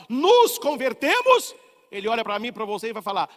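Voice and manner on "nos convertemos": higher, louder and slow, nos convertemos driven home; preaching climax. Acted. Preacher urging the faithful to conversion, loud and slow. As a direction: build to a peak of conviction.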